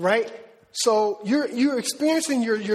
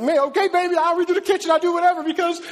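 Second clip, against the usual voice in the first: higher, louder, laughing and near tears; besotted husband; 8 semitones higher, 5 dB louder, faster than usual. Retold or imitated. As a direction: promise her anything, overwhelmed. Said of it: "you like".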